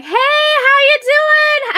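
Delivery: high, loud, drawn-out Southern holler. Acted. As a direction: holler it in a hammed-up Southern twang. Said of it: "real weird with my accent".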